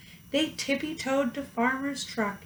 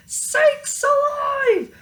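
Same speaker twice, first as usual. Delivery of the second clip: very high, loud warning scream; firemen screaming; 13 semitones higher, 7 dB louder, slower than usual. A narrator's reading. Firemen warning the mayor, very high and loud. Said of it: "The firemen screamed".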